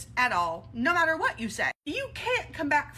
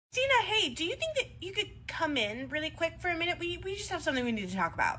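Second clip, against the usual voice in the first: a fake sweet lift, mildly higher; sounding weird; 6 semitones higher than usual. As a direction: put on a nervous, too-bright tone. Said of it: "why do you sound weird?".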